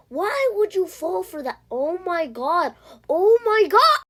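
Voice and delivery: high, loud stunned cry; shocked disbelief. Real, not acted. Watching another player fall for a trick, shocked. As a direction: gasp it out in real shock.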